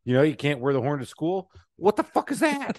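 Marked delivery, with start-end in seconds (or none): 1.8-2.8 hammed-up high yelp; mock outraged squawk. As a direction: squeal it in mock disbelief. Acted outburst at the horn.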